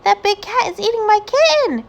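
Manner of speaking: high, loud panicky protest; alarmed cry. A narrator's reading. Amelia Bedelia alarmed that the cat eats her kitten. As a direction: sound frightened for the kitten.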